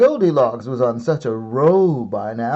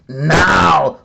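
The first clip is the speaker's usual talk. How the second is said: louder, a monster battle yell; dinosaurs yelling; 5 semitones higher, 8 dB louder than usual. A narrator's reading. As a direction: bellow it like charging beasts. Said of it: "a trio of dinosaurs yelling".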